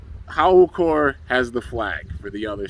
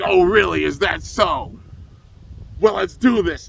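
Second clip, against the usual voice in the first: a loud, higher mock challenge; hothead getting mad; 7 semitones higher, 5 dB louder than usual. The hotheaded coach snaps back at Athena's taunts, riled mock challenge. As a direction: rise to the bait, riled up and cocky. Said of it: "Short got so mad 'cause you know he's a hothead".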